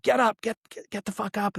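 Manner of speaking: high-pitched mock yell without real volume; mock urgent yell. Cadre waking sleeping soldiers, mock urgent yell. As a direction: imitate someone urgently rousing sleepers, high but held back.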